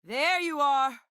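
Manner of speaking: high-pitched exclamation on finding someone; calling out. Acted. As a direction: call to someone just spotted.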